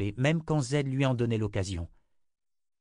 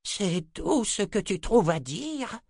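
incredulous anger; 12 semitones higher, slower than usual. Acted. Zed enraged at grandson.

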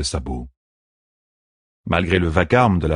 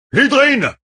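high, loud shout of a name; calling him; 6 dB louder than usual. A narrator's reading. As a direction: yell to him over the battle. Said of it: "l'appela Ituralde". (appel crié, aigu et fort)

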